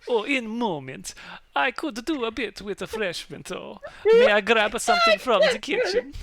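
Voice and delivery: loud, high-pitched lordly request; hammed-up baron voice; [name]. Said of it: "do you wanna take over [name]".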